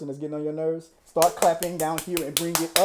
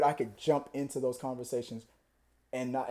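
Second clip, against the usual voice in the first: ordinary talk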